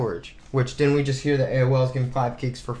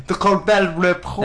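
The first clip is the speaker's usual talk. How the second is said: Colbert Report said the French way, slowly; imitating a French Colbert Report; French pronunciation; 2 semitones higher, slower than usual. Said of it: "Did you just try to speak French?".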